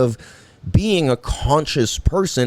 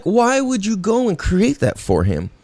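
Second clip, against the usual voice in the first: ordinary talk, nothing marked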